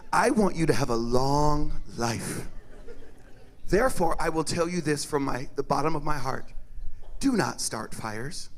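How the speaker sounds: low, tender avuncular advice; earnest, measured plea from the heart; mock-solemn sincerity. Chocolate uncle counselling children about fires, low and solemn.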